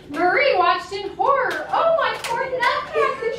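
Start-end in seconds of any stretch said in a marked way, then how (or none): 1.7-3.3 higher-pitched, mildly distressed cry; a touch of alarm for a dear friend; horrified cry. A narrator's reading. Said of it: "she cried".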